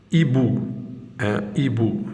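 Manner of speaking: « hibou » said ibou, H silent. Shown as a demonstration. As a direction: start « hibou » straight on the vowel, no H. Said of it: "on voit que le H, on ne le prononce pas". (« hibou » avec le H muet)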